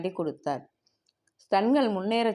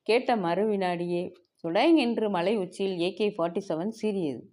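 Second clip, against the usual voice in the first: plain reading voice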